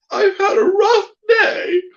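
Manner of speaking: high, loud wailing puppet; fake crying. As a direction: sob it out like a hammy puppet. Puppet George breaking down.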